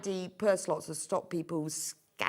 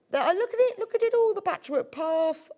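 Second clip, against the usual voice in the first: excited; 12 semitones higher, 6 dB louder than usual. Real, not acted. Presenter gushing over products.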